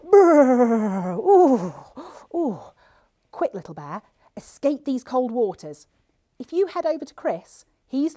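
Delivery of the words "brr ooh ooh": gasp, then loud trilled brr and oohs; pretend shivering. Polar bear seen on the river, play shivering. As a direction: shudder noisily as if freezing. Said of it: "don't forget to shiver".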